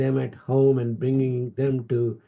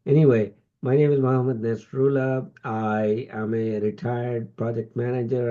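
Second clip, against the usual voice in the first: ordinary delivery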